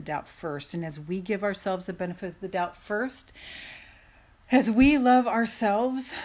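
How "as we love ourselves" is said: impassioned. Real, not acted. Sermon-like build.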